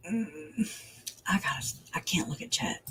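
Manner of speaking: real whisper, half to herself; whispered aside. Real, not acted. Streamer whispering to herself about not looking at chat.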